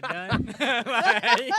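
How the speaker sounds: bursting into loud laughter. Real, not acted. Telling friends about being mistaken for Steph, loud laughter. Laughing at own celebrity mixup.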